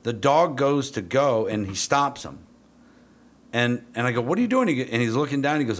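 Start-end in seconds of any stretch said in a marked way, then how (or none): 4.2-4.7 high-pitched, urgent retold interruption; alarmed disbelief. Trainer stopping a handler mid-exercise, incredulous. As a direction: cut in with a high, baffled challenge. Handler repeats the silly mistake.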